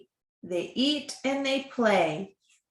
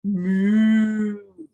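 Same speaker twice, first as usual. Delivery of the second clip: loud drawn-out moo; cow moo; 6 dB louder than usual. Acted. Cows in farm story.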